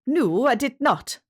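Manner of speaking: a Scottish shepherd accused of theft; indignant Scottish denial. A narrator's reading. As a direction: deny it flatly in a Scottish voice. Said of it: "answered James".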